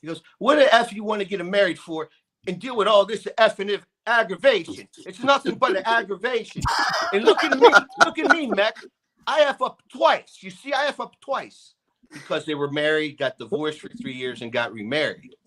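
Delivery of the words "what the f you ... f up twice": much higher, louder ranting father; father warning his son off marriage, high and loud; imitating exasperated dad. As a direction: rant like a fed-up old man, pitched up.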